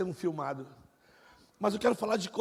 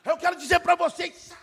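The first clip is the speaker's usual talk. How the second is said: booming preacher; 9 semitones higher, 9 dB louder than usual. Retold or imitated. Pastor opening his sermon to the church, booming shout. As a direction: proclaim it to the whole hall. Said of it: "o cara pregava assim".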